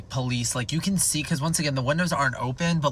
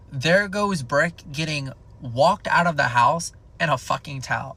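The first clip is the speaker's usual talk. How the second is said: normal talking voice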